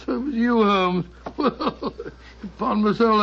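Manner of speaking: chuckling, amazed; a startled, delighted chuckle of recognition; laughing in surprise. Acted. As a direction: laugh through the words at the trick. Watson discovers Holmes's disguise.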